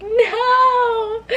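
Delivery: long, high mock wail; retold bawling. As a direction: wail it out in exaggerated despair. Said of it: "I was bawling".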